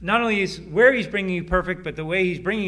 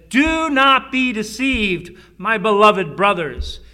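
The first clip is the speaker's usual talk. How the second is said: louder, higher and slow warning; urgent plea; 4 semitones higher, 7 dB louder, slower than usual. Acted. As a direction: plead with them not to be fooled. Preacher urging his hearers.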